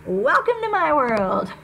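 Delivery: dry sarcastic quip. Acted. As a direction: throw it back with a smirk. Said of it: "he said sarcastically".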